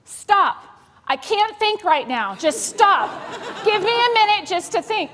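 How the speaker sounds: put-on high, loud snapping child; the girl copies mom's snap; a young girl snapping high and loud, just like mom; daughter mimicking mom. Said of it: "what is her response?".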